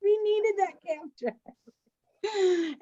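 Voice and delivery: high, slow disbelief broken by laughter; scolding him while cracking up; laughing reproach to the dreamy friend who missed the cab; exasperated laughing. Retold or imitated. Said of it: "I'm like".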